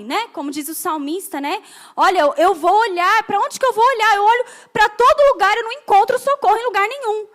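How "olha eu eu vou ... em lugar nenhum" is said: high, loud desperate searching; mounting urgency, loud and high; urgent searching. Acted. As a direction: search urgently for help, pitched up. Acts psalmist searching everywhere for help.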